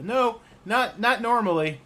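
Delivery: high, loud squeaky goblin voice; goblin Stimp. Acted. Stimp answers Rennie, high and loud. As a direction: yip it out like a tiny goblin. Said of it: "who says".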